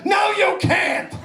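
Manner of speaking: crazy TV money adviser, high and drawn out; hammed-up, slow, high-pitched refusal; Susie Orman. Money adviser turning down a caller, crazed and emphatic. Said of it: "watch this crazy woman".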